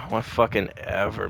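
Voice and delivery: ordinary delivery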